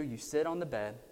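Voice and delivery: ordinary talk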